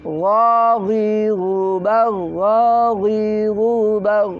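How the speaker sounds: slow chanted "ghaghiru", vowels held long; ghain pronunciation drill. Shown as a demonstration. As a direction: chant out the throat GH of "ghaghiru" slowly. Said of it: "cara pengucapan ghain". (melantunkan "ghaghiru" pelan dan panjang)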